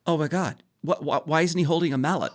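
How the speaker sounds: high, stammering mock dismay; hammed-up confusion; mock bafflement. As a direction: play an appalled, puzzled onlooker. Voices an imagined casting viewer.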